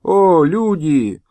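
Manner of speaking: slow, drawn-out lament; slow pleading call; old man's lament. Acted. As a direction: cry out slowly to the heavens. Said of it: "Старик поднял руки к небу".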